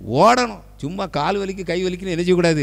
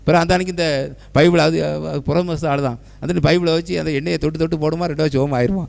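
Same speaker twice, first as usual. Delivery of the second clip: ordinary talk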